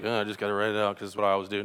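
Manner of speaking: fast, low mock voice of a casual letter writer; lazy excuse-maker.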